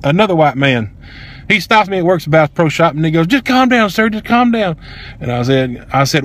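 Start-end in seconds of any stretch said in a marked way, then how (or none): 3.3-4.8 higher-pitched imitation of a store worker; urging calm. Male associate stopping upset customer, urgent calming imitation. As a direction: urge it twice in a raised pitch.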